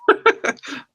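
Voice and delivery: short high laugh burst; high giggle. Real, not acted. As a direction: giggle helplessly. Reader cracking up.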